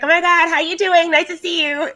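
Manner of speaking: high mock-friendly gushing voice; bubbly chatty orc. Imitating a player's cheery orc voice that doesn't fit. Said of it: "some people will play an orc and she's like".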